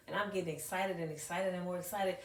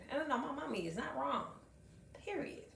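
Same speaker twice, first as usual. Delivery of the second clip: put-on sassy child; 7 semitones higher, 3 dB quieter than usual. Child defending mommy.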